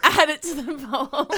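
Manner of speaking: cracking up while talking; real helpless laughter; laughing. Real, not acted. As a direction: announce it through laughter. Still cracking up at own haiku.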